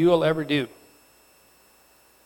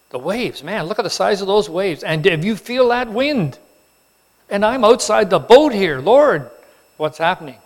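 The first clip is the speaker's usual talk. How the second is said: mock panic; 5 semitones higher, 5 dB louder than usual. Peter panicking outside the boat.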